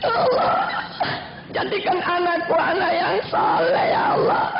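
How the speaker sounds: acted weeping plea, breaking up; mock crying. Father weeping while praying for child, mock sobbing. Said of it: "ikut juga menangis".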